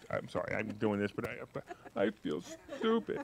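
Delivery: soft, pitiful "I feel stupid"; mock whiny imitation. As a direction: mimic her sheepish mumble, lightly. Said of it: "she didn't say it like this".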